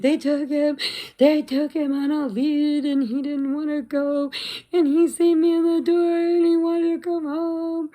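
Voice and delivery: high, loud wailing lament; hammed-up meltdown. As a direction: wail it like an exaggerated tearful breakdown. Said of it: "And I lost it".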